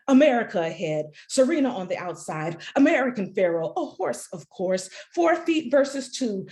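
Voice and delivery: lightly voiced track announcer, building to the finish; horse race announcer. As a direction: call the race as it builds, then fade out. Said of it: "in the voice of a horse race announcer".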